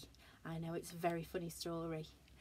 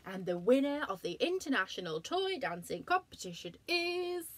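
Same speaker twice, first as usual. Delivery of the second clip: announcer voice; 5 semitones higher, 5 dB louder than usual. Acted. Announcer at competition.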